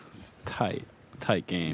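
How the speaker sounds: whisper. Real, not acted. Aside during cooler banter.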